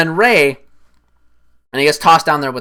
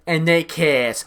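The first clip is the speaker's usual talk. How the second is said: flat expectation; 3 semitones higher, slower than usual. Retold or imitated. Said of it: "I was like".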